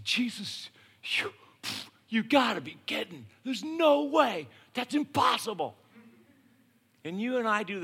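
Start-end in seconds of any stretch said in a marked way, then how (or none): 0.1-4.5 panicked disbelief, slowing down on no way; a disciple caught off guard, stammering in alarm; freaking out. Acted. Philip to Jesus, panicked mock disbelief. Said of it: "freaks out". 4.7-5.8 "impossible" said with a higher, strained voice of disbelief; Philip's exasperated "impossible", in character; mock disbelief. Philip to Jesus, a touch of exasperated disbelief. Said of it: "freaks out".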